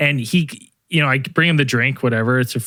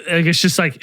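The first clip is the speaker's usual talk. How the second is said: ordinary talk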